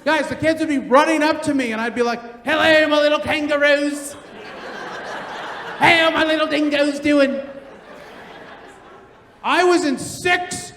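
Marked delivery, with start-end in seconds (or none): 2.5-7.4 big put-on Australian greeting; Australian accent. Delivery driver greeting kids running up, in a hearty Australian accent. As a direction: hail the kids as a hammed-up Australian. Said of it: "For the Australian".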